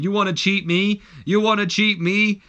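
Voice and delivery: high, loud furious yell; screaming. Retold or imitated. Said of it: "pretty much screaming".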